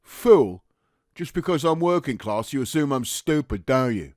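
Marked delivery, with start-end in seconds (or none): 0.0-0.6 loud, sharp challenge; offended echo. Acted. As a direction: throw the insult back, stung. Private throws the insult back.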